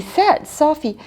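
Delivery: plain reading voice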